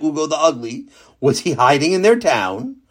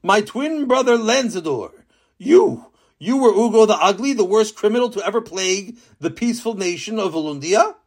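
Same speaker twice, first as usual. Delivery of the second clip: shocked disbelief; 7 semitones higher than usual. Acted. King confronts his twin.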